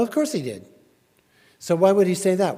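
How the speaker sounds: ordinary delivery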